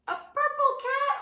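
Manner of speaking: purple jumps very high in a rising question; mock surprise. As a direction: gasp at the silly purple cat for the kids. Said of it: "Oh my gosh".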